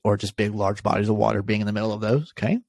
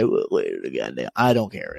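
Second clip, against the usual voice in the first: low, gruff mocking of a local; much lower, grumbling mock protest; mock Southern fisherman; 10 semitones lower than usual. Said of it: "you're gonna say".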